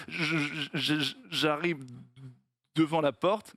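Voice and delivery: haltingly stuttered, snagging on first sounds; stuttering salesman. Acted. Stutterer in a joke explains his sales method, stammering. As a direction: act out a stammer with repeated syllables. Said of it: "au mec qui bégait".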